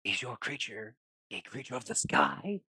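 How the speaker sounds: put-on YouTuber voice; performed question in a borrowed voice; imitating a favorite YouTuber. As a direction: ask it as if someone else were asking. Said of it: "as a nod to her and her favorite YouTuber".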